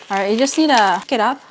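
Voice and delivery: ordinary talk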